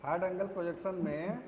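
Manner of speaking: faint broken call audio; cutting out. Real, not acted. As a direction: voice dropping out on a bad line. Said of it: "आवाज आपकी clear नहीं आ रही".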